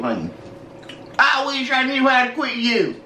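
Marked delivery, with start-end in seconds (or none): 1.2-3.0 country accent. Shown as a demonstration. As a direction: fake Southern country twang, laughing. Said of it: "put in a country accent for you".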